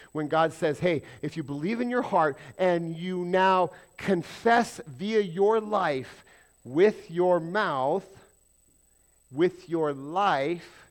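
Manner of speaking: loud, drawn-out, rising sermon voice; slow full-force preaching. Real, not acted. Pastor quoting God to the church, loud and very slow. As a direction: stretch every word out and press it home.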